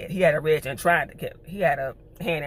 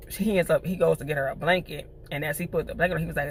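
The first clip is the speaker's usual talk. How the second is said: ordinary talk, nothing marked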